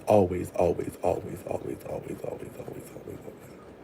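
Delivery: fading away. Retold or imitated. Narrating Maurice always following her, fading to a whisper. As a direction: let the repeated always die out to a whisper.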